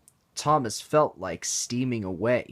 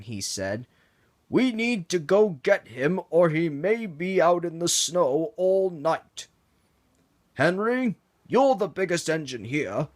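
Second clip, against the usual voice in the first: lightly voiced boss, higher and firm; Sir Topham Hatt; 7 semitones higher, 4 dB louder than usual. Sir Topham Hatt ordering Henry to rescue Toby, urgent.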